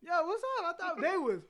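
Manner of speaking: high, loud retold schoolyard exchange; animated retelling.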